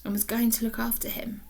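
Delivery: whispered storytelling; hushed. A narrator's reading. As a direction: drop to a secret whisper. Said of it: "hushed suspense narration".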